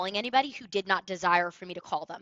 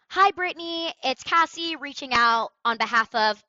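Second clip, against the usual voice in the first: sample intro; 2 semitones higher, 7 dB louder than usual. Shown as a demonstration. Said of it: "took four different intros".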